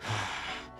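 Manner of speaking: soft, short sigh; weary sigh. Acted. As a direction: let out a quiet, puzzled sigh. Puzzled over lost memories.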